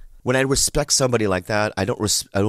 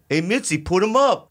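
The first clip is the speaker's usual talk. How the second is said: higher imitated call-out; retold Carlos; 9 semitones higher than usual. Carlos asking Mitzi to put him up, high calling voice. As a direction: call across the room as Carlos, pitched up.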